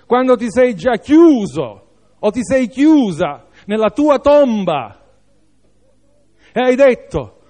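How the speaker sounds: emphatic preaching with punched phrase ends; impassioned preaching. Real, not acted. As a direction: drive each phrase home, high and loud.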